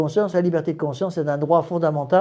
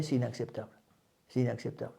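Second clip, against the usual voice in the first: much softer, quoted protest; hushed objection; 3 semitones lower, 13 dB quieter, faster than usual. Retold or imitated. Modernist protesting to the abbé, in a hushed voice. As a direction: imitate an objector under the breath.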